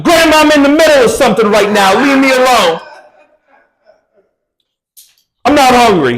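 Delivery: shouting to get rid of grandma; annoyed shout. Retold or imitated. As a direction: bark her away, irritated. Snapping at grandma at door.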